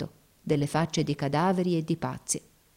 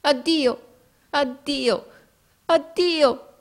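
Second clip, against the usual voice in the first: high, anguished repeated farewell; grido fra i singhiozzi; 10 semitones higher, 5 dB louder, much slower than usual. A narrator's reading. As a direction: call goodbye as if through sobs. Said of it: "gli gridò fra i singhiozzi". (addio acuto e straziato)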